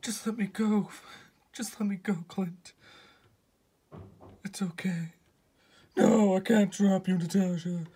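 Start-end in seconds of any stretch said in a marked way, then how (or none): 1.5-2.7 breathy pleading whisper. Acted. Natasha hanging from cliff. 4.4-5.1 soft unvoiced whisper. Natasha hanging from cliff.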